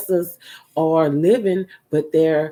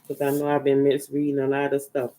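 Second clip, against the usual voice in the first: ordinary delivery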